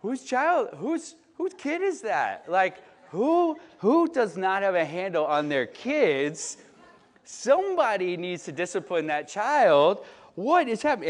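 high, loud hammed-up outrage at someone else's kid; mock indignant parent. Pastor voicing himself pretending the child isn't his, in mock outrage. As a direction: play the scandalised guest pointing fingers. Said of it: "Nicole and I are like".

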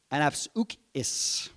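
Anapsukis said slowly, each syllable laid out; Greek word spelled out. Shown as a demonstration. Pastor teaching the Greek word, slow careful pronunciation. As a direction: sound out Anapsukis for the congregation slowly. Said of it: "A N A P S U X I S".

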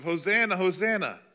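high, loud festive cry of Hosanna; joyful crowd cry. Retold or imitated. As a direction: call it out like a celebrating crowd. Crowd celebrating Jesus.

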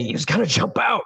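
high, insistent accusing cry; urgent accusation. Acted. Player pointing at the exit to accuse a captive, urgent accusation.

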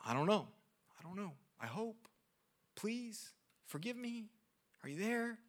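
hesitant hushed plea; timid prayer. Retold or imitated. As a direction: pray like you doubt anyone is listening. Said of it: "or I'm just like".

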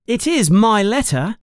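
high, indignant insistence; furious. A narrator's reading. Harry claims his own letter, furious protest. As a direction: insist angrily in a high boy's voice. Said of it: "Harry says furiously".

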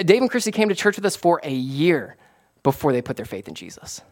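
ordinary talk

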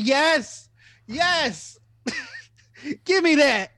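high, exultant cheer; high-pitched mock jubilation; hammed-up triumph. Celebrating getting into the party.

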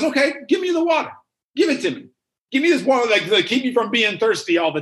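woman calling out, high and eager; eager shout. Acted. Woman demands the water, eager shout. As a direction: grab at the offer, hammed-up. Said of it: "she says".

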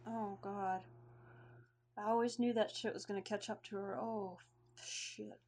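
quiet, subdued shock; hushed, resigned sorrow; stunned grief. Acted. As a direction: take in the loss, voice dropping away. Host takes in friend's death.

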